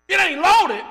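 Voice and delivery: high, sudden comic shout; loud outburst. Acted. Preacher answering whether the gun is loaded, shouted. Acted outcry in gun exchange.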